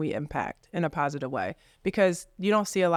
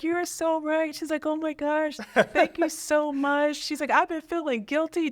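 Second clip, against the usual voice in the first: grateful client; 10 semitones higher than usual. Retold or imitated. Client thanking the agent for her advice, high and gushing.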